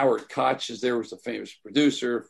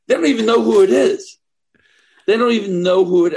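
loud, much higher retold outburst of disbelief; indignant exclaim; 9 semitones higher, 10 dB louder than usual. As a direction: half-shout the disbelief as he did then. Retold outrage in the restroom.

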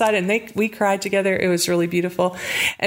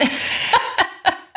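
high breathy laugh; 13 semitones higher than usual. Real, not acted. Amazed by the fifty thousand dollar gift, laughing high. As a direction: burst of high, airy laughter.

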